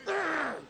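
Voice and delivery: high-pitched imitated snarl; dog growl. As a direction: growl like a small guarding dog. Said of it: "the dog goes".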